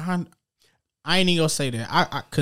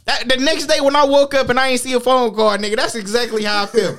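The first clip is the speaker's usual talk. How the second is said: laughing complaint; 8 semitones higher, 3 dB louder than usual. Real, not acted.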